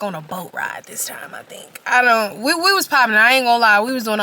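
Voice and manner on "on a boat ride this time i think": unvoiced hushed aside; whispered aside. Real, not acted. Unsure of the details, a whispered aside. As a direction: drop to a whisper mid-thought.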